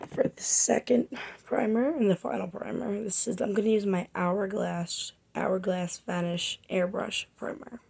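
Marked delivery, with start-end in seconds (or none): none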